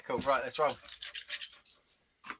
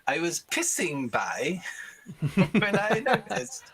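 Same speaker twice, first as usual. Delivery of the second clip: put-on French accent; 3 semitones higher, slower than usual. Mock French character intro.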